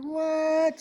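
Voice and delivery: a sudden high What; shocked squeak of disbelief; mock shock. Dancer stunned by the officer's request, high mock shock. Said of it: "I'm like".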